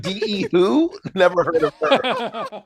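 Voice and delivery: high, dismissive shrug of a put-down; mock dismissive. Brushing off a rival name: mock dismissive drawl. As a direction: feign never hearing of her, slowly. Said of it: "I'm like".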